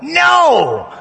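high, loud comic yell. Retold or imitated. Seminarians heckling a reader in chapel, yelled refusal. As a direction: shout it like a whole heckling crowd. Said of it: "we all yelled out".

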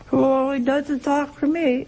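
a touch of sulky churchgoer; mock complaint. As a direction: mimic the person who says God is silent.

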